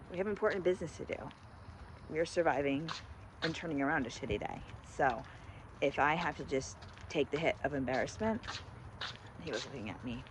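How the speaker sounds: hushed and low so passersby won't hear; embarrassed quiet voice. Real, not acted. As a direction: keep it down, self-conscious. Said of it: "I'm getting embarrassed".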